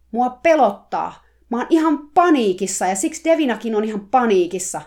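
finally snapping, yelling out the fear; panicked shout. Acted. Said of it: "hän huusi ääneen". (paniikissa huudettu purkaus)

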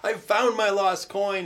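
high, loud, jubilant call; joyful announcement. Acted. Woman calling friends and neighbors to rejoice, joyful announcement. Acting woman who found coin.

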